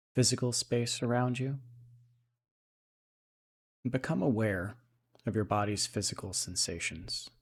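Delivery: soft, unhurried meditation voice with long pauses; guided meditation. Acted. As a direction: settle into a quiet, even, calming guide's voice.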